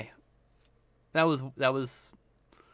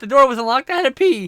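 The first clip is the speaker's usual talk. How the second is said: high, louder defensive whine; whiny excuse; 9 semitones higher, 7 dB louder than usual. Retold or imitated. Roommate excusing himself to the couple. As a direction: plead innocence in a high voice. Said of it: "He's like".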